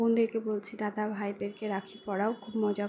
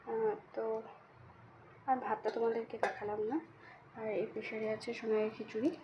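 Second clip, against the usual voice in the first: speaking softly; 6 dB quieter than usual. Real, not acted. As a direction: keep your voice down, softer than usual. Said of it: "আরও আস্তে কথা বলতে হবে".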